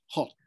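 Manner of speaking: "hot" ending in an audible, aspirated T. Shown as a demonstration. Teacher contrasting for a student, "hot" with the T sounded. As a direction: finish "hot" on a crisp released T. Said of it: "with a T at the end".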